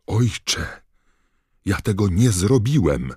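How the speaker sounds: high, loud protest of innocence; pleading shout; desperate cry. A narrator's reading. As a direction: cry out to a father for mercy. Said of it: "krzyknął pułowowłosy młodzieniec".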